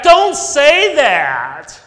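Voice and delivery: put-on child yelling back. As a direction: shout it back like a stubborn six-year-old. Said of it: "John yelled back".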